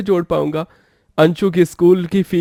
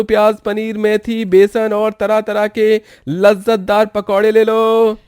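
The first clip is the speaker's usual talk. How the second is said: hawker's call; 5 semitones higher than usual. Acted. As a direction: call out to passers-by to sell pakoras. Street vendor calling out pakoras. (ज़ोर से आवाज़ लगाता फेरीवाला)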